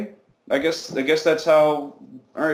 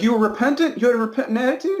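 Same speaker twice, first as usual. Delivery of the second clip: much higher, fast, half-laughing disbelief; incredulous questions; 10 semitones higher, faster than usual. Retold or imitated. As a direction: ask it as if it's absurd. Dad questions his son, disbelieving.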